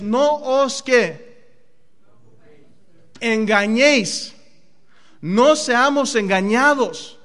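emphatic preacher warning; preached warning. Acted. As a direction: drive the warning home, slowly. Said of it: "warning emphasis in sermon".

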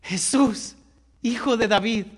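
one final cry with everything left; last cry. Acted. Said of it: "dio su último grito".

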